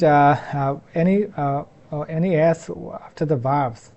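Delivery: usual speaking voice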